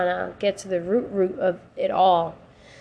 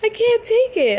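much higher and louder in exasperation; exasperated; 6 dB louder than usual. Real, not acted. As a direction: throw up your hands, half laughing. Exasperated outburst.

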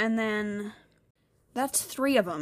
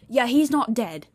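louder, drawn-out insistence; retold firm correction; 3 semitones higher, 6 dB louder than usual. Mum cuts in on talk about Kenrick, retold firm correction. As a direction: imitate mum correcting, slow and emphatic. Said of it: "my mum immediately said".

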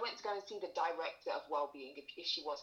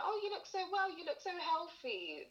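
mock gushing; 12 semitones higher than usual. Mocking the wellbeing director.

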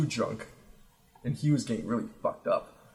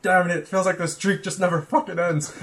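higher, louder mock complaint; mock exasperation; 6 semitones higher, 5 dB louder than usual. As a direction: imitate a fed-up drinking buddy. Retold friend's complaint about endless drink.

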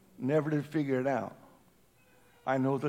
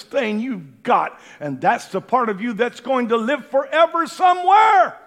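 much higher, shouted appeal; fiery preaching; 8 semitones higher, 12 dB louder than usual. Acted. Preacher warning the congregation not to gamble on tomorrow, shouting. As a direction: preach it at full force.

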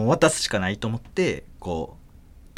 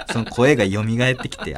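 usual speaking voice

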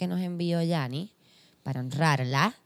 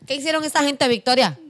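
outraged; 7 semitones higher, 5 dB louder, faster than usual. Real, not acted. Outraged rant about prayer credit.

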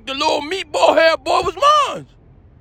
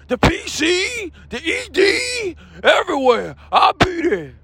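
Jamal Dean. Acted. Showman announcing his tour to fans, hyped stretched delivery. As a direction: greet the crowd with stretched, rousing calls. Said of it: "Jamal Dean, are you here?".

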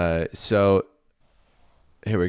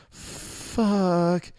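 panicked curse; 14 semitones higher than usual. Acted. Acolyte sees soaked pages.

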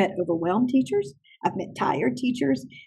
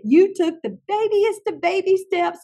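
higher, teasing amazement; acted-out delighted marvelling; playful praise; 8 semitones higher than usual. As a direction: gush playfully over a child's tiny success. Retold humorous praise of child.

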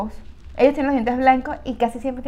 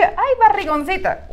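slow, higher teasing pet name; mock sweet insult; 6 semitones higher, slower than usual.

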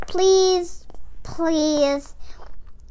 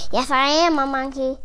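ordinary delivery, nothing marked

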